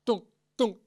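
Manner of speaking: short, high, punched thunk sounds; imitated thunk. Lecturer imitating a sound heard in the womb, imitated thunk. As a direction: punch out a sudden high knocking noise. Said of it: "What is that dunk sound".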